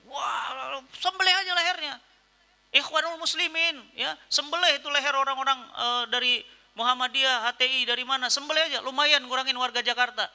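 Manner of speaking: high, loud mock of a ranting cleric; sneering parody of a hateful sermon; mocking a harsh preacher. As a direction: ham up a harsh preacher calling for violence. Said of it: "Ada di YouTube tuh tausiahnya".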